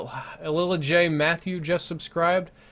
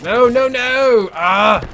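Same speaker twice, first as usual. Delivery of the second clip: loud, high "no, no, no"; frustrated shout; 8 semitones higher, 10 dB louder, much slower than usual. Real, not acted. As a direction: yell it out in dismay. Dying in game, shouting.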